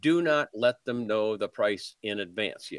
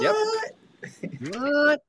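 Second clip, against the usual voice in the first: surprised; 5 dB louder than usual. Retold or imitated. Agent and buyer in role play.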